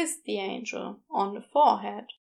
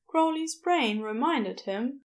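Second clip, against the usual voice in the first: usual speaking voice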